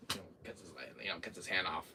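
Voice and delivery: ordinary delivery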